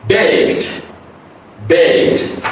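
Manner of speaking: 'baked' said as baykt; one-syllable 'baked', light final T; unvoiced T ending. Shown as a demonstration. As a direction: end 'baked' on a crisp k plus T. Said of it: "because of this unvoiced".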